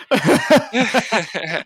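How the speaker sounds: word dissolving into laughter; laughing. Real, not acted. Laughing at his own joke.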